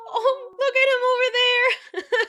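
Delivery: high, loud cooing squeal; gushing, very high pitch; delighted squeal. Real, not acted. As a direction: squeal at something adorably funny. Reacting to bat David.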